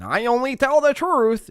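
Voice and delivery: high, slow, mock-earnest politician. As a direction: play the smug honest politician. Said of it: "She sounds like a politician".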